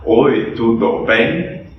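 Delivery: slow, each syllable separate like a foreign learner; a gringo spelling out "Oi, tudo bem?" slowly. Retold or imitated. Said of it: "aquele gringo que vem pro Brasil e fala".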